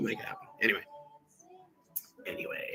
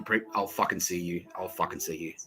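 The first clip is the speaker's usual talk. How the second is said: ordinary delivery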